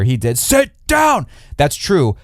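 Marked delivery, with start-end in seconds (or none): 0.4-1.3 much higher, sharp angry command; angry exclaim. Retold or imitated. Kristen Cole ordering Lyman to sit, mock angry yell. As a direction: bark the order at the old man. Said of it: "he exclaimed in a lot of anger".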